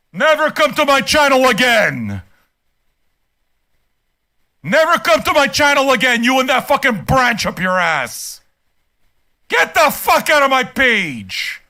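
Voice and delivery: furious, emphatic dismissal; angry banishing. Real, not acted. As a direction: yell at the commenter to stay away. Banning the commenter from the channel.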